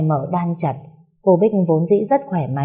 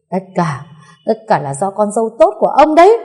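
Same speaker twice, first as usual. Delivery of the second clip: loud, high bitter blame; accusing outburst climbing at the end; tearful accusation; 4 semitones higher, 6 dB louder than usual. A narrator's reading. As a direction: lay the blame in distraught anger. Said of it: "khóc nấc lên, rồi chỉ tay".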